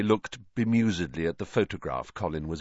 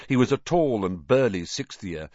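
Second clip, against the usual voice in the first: ordinary delivery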